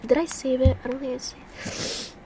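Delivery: muttered whisper; whispered to herself. Real, not acted. Hunting for the video, whispering to herself. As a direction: whisper while scrolling. Searching phone.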